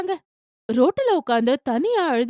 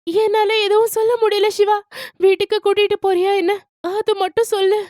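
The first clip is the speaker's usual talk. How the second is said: higher, louder, pleading; distressed young wife; 8 semitones higher, 3 dB louder than usual. A narrator's reading. Wife begging Siva to take her home, higher and louder. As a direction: beg to be taken home, close to tears.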